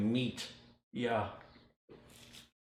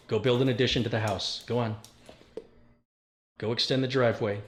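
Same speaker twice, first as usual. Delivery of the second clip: joking commands; 5 dB louder than usual. Real, not acted.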